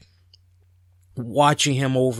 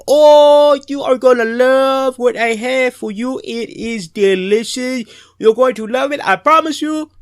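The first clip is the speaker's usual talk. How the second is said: put-on high, loud, proud host voice in an African accent; 10 semitones higher, 7 dB louder than usual. Host teasing his guests about dinner, loud African accent. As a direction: promise the treat in a beaming African accent. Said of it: "he was like".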